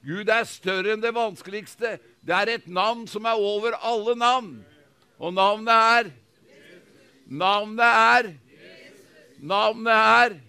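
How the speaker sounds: loud, rising preacher's proclamation; full-force preaching. Acted. Pastor proclaiming to the congregation, loud preaching. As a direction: preach it out to the whole hall.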